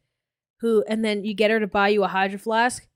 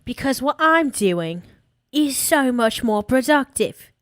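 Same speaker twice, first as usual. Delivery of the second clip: bad British accent; 2 semitones higher than usual. Streamer mocking a British-Polish vlogger, in a bad British accent. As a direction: put on a badly faked British woman's voice. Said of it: "if I try to do the British accent".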